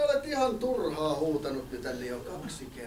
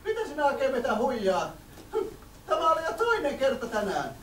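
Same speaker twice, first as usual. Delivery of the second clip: flustered villager woman; 6 semitones higher than usual. Acted. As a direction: squeal in a panic like a fussy villager. Annoyed villager.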